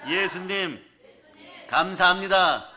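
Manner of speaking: loud, slow ringing proclamation; shouted declaration. Shown as a demonstration. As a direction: shout it out to the congregation. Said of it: "한번 외쳐보세요".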